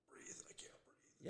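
breathless whisper, barely any voice; panicked whisper. Retold or imitated. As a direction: whisper it slowly like you're gasping for air in a nightmare. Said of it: "you're like".

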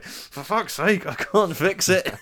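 high, slow, drawn-out mock exasperation; exasperated housemate. Housemate grumbling about his ruined Netflix suggestions, slow, high and exasperated. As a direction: act out the fed-up housemate stuck with the watch list. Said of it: "and he's like".

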